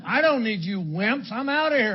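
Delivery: indignant retold outburst, punched out with force; mock scornful tough guy. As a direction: act out a cocky, contemptuous kiss-off. Acts his drunken scorn for drinking buddies.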